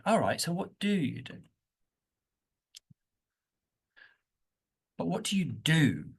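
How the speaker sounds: what do you do, weight on do; stress on do. Shown as a demonstration. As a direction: do stressed, falling tune. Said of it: "what's your action?".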